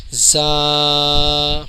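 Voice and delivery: زا with a buzzing z, long level aa; whistled z. Shown as a demonstration. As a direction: buzz the start of زا and hold the vowel. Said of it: "یہ صحیح تلفظ ہے".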